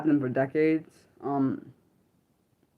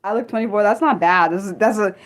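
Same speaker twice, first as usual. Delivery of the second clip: ordinary talk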